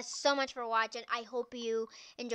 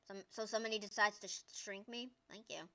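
normal talking voice

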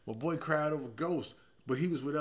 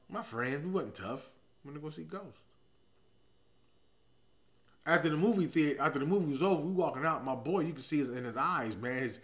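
ordinary delivery

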